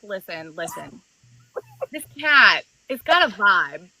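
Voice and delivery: ordinary talk, nothing marked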